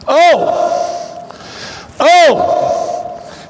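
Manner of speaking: "Oh" cried high and drawn out; "Oh" as a sudden high exclamation; emphatic OH. Shown as a demonstration. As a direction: burst out "Oh" with full emphasis. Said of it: "That's emphasis is what that is".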